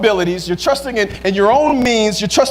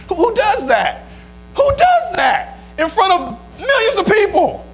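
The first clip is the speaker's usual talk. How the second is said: high-pitched, indignant disbelief; incredulous outburst; 7 semitones higher than usual. Real, not acted. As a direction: exclaim it high, as if you can't believe it.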